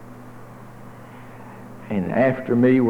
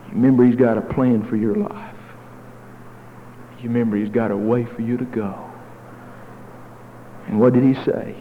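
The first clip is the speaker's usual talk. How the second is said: usual speaking voice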